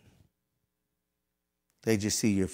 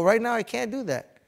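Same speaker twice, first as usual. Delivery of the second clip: high-pitched frustrated refusal; mad retold protest; 12 semitones higher, 5 dB louder than usual. Trainee refusing the task for now, exasperated. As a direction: act out a fed-up refusal. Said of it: "And I was mad".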